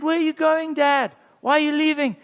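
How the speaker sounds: put-on high child voice asking; kids asking. Children asking their dad why he leaves, in a high voice. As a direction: sound like a confused little child. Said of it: "His kids said".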